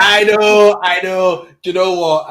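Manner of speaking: excited. Real, not acted. Excited reply, much louder.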